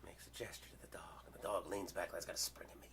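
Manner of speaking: voiceless, hushed whisper; frightened hushed narration; tense whisper. Real, not acted. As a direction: whisper as if the dog could hear. Dog about to spring.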